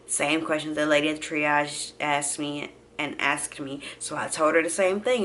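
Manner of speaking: plain reading voice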